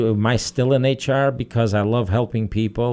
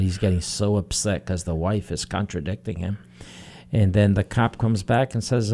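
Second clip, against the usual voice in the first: ordinary talk, nothing marked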